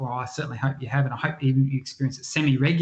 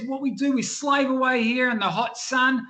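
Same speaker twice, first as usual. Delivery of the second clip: much higher, incredulous worker's voice; exasperated labourer; 9 semitones higher than usual. Acted. Quarry worker scoffs at the visitor in a high, incredulous voice. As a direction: scoff at the silly question from up high.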